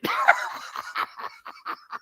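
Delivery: breathless high cackle; high wheezing laugh. Real, not acted. Dad's story cracking him up, airy laugh.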